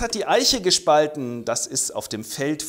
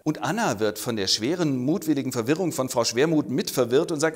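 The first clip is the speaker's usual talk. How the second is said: normal talking voice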